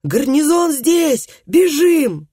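high, loud, slow soldier yell; fleeing mercenaries crying out in panic; hoarse panicked cries. A narrator's reading. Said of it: "с хриплыми криками".

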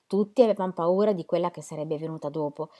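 plain reading voice

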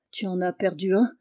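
plain reading voice

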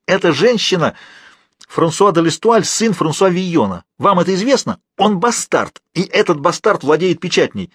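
high, malicious outburst; sudden spite. A narrator's reading. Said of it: "выкрикнул Фалуа с неожиданной злобой". (злобные выкрики на высоких тонах)

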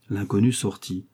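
ordinary delivery, nothing marked

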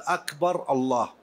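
أكبر الله with the words swapped, shown as the wrong form; أكبر الله demo of the wrong word order; reversed order. Shown as a demonstration. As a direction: say أكبر first, then الله. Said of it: "لم تصح".

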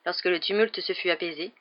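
ordinary delivery, nothing marked

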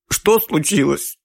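high, slow sobbing question. A narrator's reading. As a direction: sob the words out. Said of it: "взрыднула женщина".

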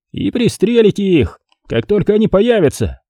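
high-pitched, perked-up suggestion; cheered up. A narrator's reading. Drake adds to Carter's ambush plan, suddenly cheered up. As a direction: chime in high, mildly cheered. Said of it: "добавил повеселевший Дрейк".

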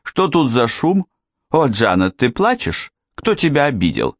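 put-on high-pitched curious child; young girl. A narrator's reading. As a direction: pipe up with questions as a little girl. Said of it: "крикнула девочка". (высокий голос любопытной девочки)

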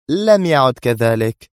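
stern. A narrator's reading. Sonia interrupts the man to correct him, stern. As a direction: cut in sternly, drawing it out. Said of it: "قاطعته في صرامة".

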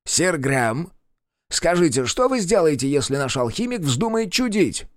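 a high-handed royal advisor giving orders. Acted. Advisor questions soldier. (голос советника)

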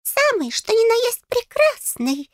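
very high, slow, grandiose little voice; tiny fairy putting on pathos; pompous fairy. A narrator's reading. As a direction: squeak it out with great pomp. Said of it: "пафосно отозвался он".

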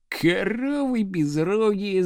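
slow, spiteful growl; vicious growl. A narrator's reading. Pupil turning on the girls, viciously growling. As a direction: growl the insult out, drawing it slowly. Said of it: "злобно прорычал Градусов девицам".

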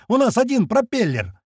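high, heated protest; exasperated outburst. A narrator's reading. Pilot with one propeller left, exasperated cry. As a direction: protest at a high, straining pitch. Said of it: "взрывается Бат".

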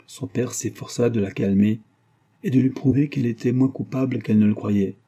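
plain reading voice

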